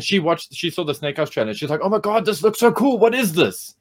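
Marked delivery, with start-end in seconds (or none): 1.8-3.7 high-pitched imitation of an amazed viewer; retold excited reaction. Teacher imitating intern seeing the trailer, excited. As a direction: play her thrilled, higher-pitched first reaction.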